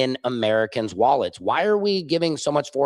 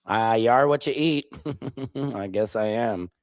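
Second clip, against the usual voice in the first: ordinary delivery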